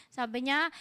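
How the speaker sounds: normal talking voice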